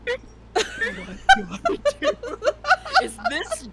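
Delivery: laughing. Retold or imitated.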